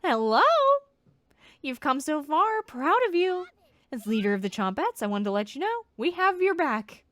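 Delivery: squeaky, loud little leader; chirpy, bossy critter voice; high loud cheerful creature voice. Acted.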